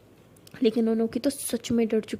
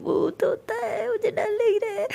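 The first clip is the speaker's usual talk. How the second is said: crying in fear; 9 semitones higher than usual. Acted. Anokhi terrified of ghosts.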